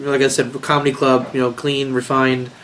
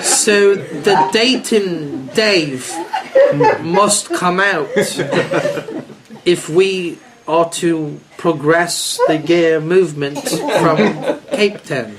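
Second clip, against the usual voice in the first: put-on very slow, overly articulated South African accent, laughing through it; mock South African, each word said as it is; laughing South African impression, slowly spelling out each word; 3 semitones higher, slower than usual. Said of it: "we could speak like this".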